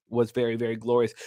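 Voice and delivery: ordinary delivery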